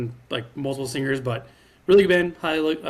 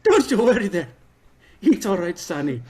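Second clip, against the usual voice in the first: higher, laughing Irish brogue; Irish accent; 4 semitones higher than usual. Pretend. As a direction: soothe like a jolly Irishman. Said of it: "He leans into it".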